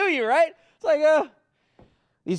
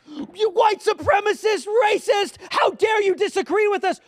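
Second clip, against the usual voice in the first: much higher, louder accusing screech; mocking woke accuser; 10 semitones higher, 5 dB louder than usual. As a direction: ham up the outraged accuser.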